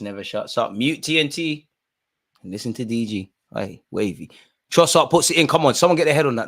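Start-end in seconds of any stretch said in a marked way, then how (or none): 4.7-6.5 shouting at screen. Real, not acted. Yelling at the players on screen.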